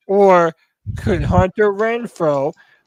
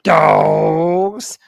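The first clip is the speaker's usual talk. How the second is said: drawn-out hype; 3 semitones lower than usual. Acted. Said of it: "how are we calling them?".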